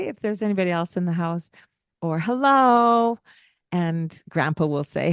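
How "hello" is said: higher, louder friendly hello; modeling a greeting at the door; cheery hello. Shown as a demonstration. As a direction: sing out a bright hello.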